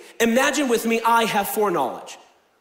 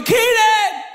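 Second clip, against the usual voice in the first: high, louder call of a name; 13 semitones higher, 5 dB louder than usual. Retold or imitated. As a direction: call across the room to get his attention. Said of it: "You go".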